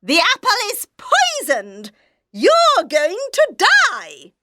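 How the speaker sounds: high-pitched triumphant wicked queen; gloating queen. Acted. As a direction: gloat over her victim.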